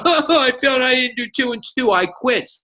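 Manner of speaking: high mock whine of a quitter; hammed-up baby whine; whiny baby. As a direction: whimper it like a sulky child. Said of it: "Don't be a baby about this".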